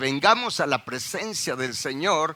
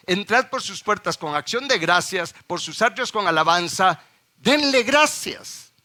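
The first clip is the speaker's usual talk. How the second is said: scripture reading; 4 semitones higher, 3 dB louder than usual. Acted. Reading the verse aloud.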